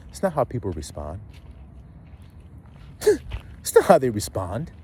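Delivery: ordinary talk, nothing marked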